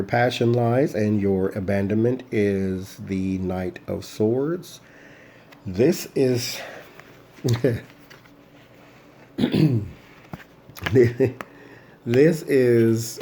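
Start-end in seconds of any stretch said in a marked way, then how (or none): 5.6-13.2 laughing. Real, not acted. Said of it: "I don't know why I'm laughing".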